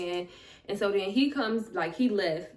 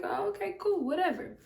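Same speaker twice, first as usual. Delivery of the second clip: dismissive; 9 semitones higher than usual. Retold or imitated. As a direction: shrug off a snub, much higher. Retells own reaction to snub.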